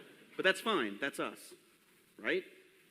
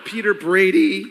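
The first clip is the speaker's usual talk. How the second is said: cracking voice; 4 semitones higher, 12 dB louder than usual. Shown as a demonstration. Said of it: "my voice is cracking".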